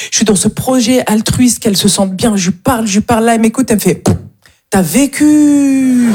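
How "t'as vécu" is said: awed drawl. Retold or imitated. Young woman marvels at her age.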